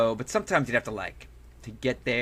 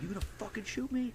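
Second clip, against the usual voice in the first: mock furious challenge, pitched up; going nuts; 11 semitones higher, 5 dB quieter than usual. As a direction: imitate losing it at a threat. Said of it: "I just went nuts".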